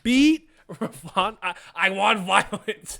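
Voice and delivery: loud laughing hype cheer; gleeful cheering. Real, not acted. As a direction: cheer the beatdown on. Cheering on the beating.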